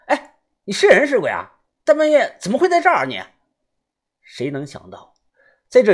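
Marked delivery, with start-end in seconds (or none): 0.1-3.2 high, loud, fast rebuke; loud scolding. A narrator's reading. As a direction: bark at the stranger to explain himself. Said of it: "豆芽仔大声地呵斥他".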